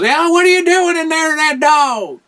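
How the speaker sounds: high, loud cackly granny call; old granny voice. Retold or imitated. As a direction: yell at him from the other room like a hillbilly granny. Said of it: "And then Granny goes".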